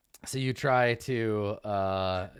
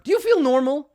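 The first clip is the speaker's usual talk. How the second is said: high anxious question; 11 semitones higher, 7 dB louder than usual. Acted. Sembra asks Pint anxiously.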